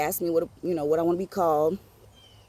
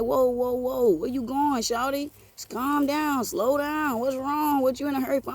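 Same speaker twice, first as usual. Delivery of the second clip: higher-pitched "calm down" coaxing; lightly voiced Big T; 7 semitones higher than usual. Neighborhood OG stopping a running kid, lightly acted calming. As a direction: act out slowing someone down, pitched up. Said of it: "He like".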